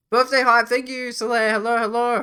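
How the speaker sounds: high, loud cheerful hello; bright burst greeting a viewer; excited greeting. Real, not acted.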